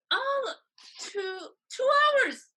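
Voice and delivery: high, strained gasp of shock; stunned disbelief. Retold or imitated. Told the tour leaves in hours, stunned high disbelief. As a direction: choke out the news like it can't be real.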